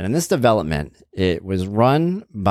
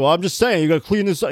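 higher-pitched, defensive mimicry; backpedaling neighbor; 10 semitones higher than usual. Neighbor backing off from the angry mover, high defensive voice. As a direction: back down in a high mock voice.

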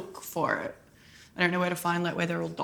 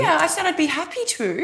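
high, loud, defensive comeback; indignant protest; 9 semitones higher, 5 dB louder than usual. Real, not acted. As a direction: insist loudly in a raised pitch. Bickering over who wanted the film.

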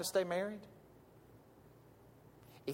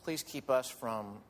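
prayer; 4 semitones lower than usual. Acted. Pastor leads closing prayer.

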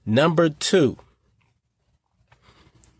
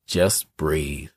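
calm soft; 8 semitones lower, 4 dB quieter than usual. Real, not acted. Recap tip, calming delivery.

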